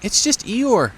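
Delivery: small high squeaky piglet voice. A narrator's reading. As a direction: play a tiny timid piglet, high up.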